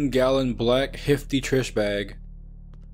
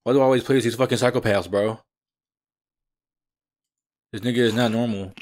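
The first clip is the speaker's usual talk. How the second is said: normal talking voice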